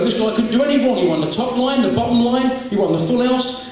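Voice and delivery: ordinary talk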